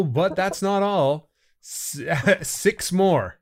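hammed-up TV pitchman, rising excitement; infomercial. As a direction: sell it like a late-night infomercial. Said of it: "Remember the old commercials".

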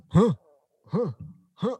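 short mock grunts, spaced apart; three separate puffs of stiff-necked effort; effortful grunts. Imitating stiff Batman turning all the time, mock grunts. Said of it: "So instead of going".

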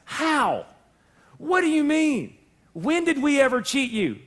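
mock innocent protest. People protesting innocently to God, a touch of mock hurt. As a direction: play dumb, wide-eyed. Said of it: "But you ask".

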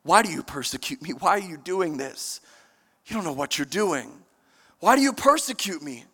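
soft, low, tender pleading; tender father. Acted. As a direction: speak like a loving father to a lost son. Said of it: "love of a father that says".

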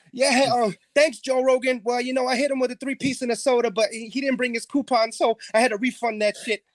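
a dopey champ rambling post-fight; mock fight winner. EP playing the winner in his mock interview, goofy voice.

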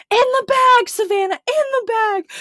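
high, loud urgent commands; imitating frantic orders. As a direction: bark it at a sick friend. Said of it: "she's like".

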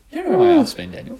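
very high mimicking prim English mum; teasing high-pitched English lady impression; prim English accent. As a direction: squeak it in a mock English accent. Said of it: "in this prim little English accent".

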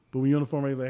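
usual speaking voice

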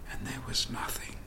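breathy whisper, soft and still; hushed whisper. Retold or imitated. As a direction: whisper it like the hall just went dark. Theatre falls silent at the end.

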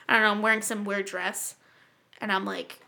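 normal talking voice

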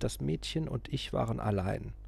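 usual speaking voice

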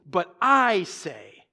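slow, with a long stressed I; emphatic I. Retold or imitated. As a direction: draw out I for emphasis. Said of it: "The I is emphatic".

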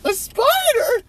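shrill frightened cry; screaming. Acted. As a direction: scream the word in horror. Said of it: "Miss Muff is screaming".

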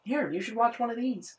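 high, soft imitation of mom; mock mom offering a treat; mom's coaxing. Voicing mom offering the films.

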